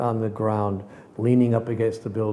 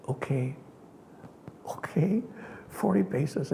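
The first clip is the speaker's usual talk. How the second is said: soft, higher, half whispered okay; hushed acceptance; 3 semitones higher, 7 dB quieter than usual. Retold or imitated. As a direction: give in quietly, amazed.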